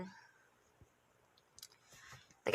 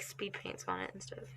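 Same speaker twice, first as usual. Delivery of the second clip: whispering; 17 dB quieter than usual. Real, not acted. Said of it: "can't talk".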